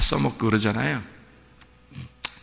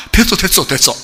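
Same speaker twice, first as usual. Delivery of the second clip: relieved laughing stop; 7 semitones higher, 6 dB louder than usual. Acted. God stopping Abraham, relieved.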